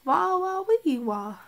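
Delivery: loud playful nonsense sounds; silly loud wah noises. Real, not acted. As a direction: belt out goofy wah sounds. Silly noises after plug.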